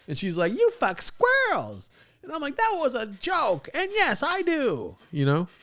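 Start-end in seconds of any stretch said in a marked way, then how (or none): 0.5-1.9 high, drawn-out mock accusation; hammed-up quarrel voice, slow and high; mock comeback. Girlfriend's comeback in acted quarrel. 2.6-5.0 high, slow mock indignation; hammed-up defensive squawk; mock retort. His retort in acted quarrel.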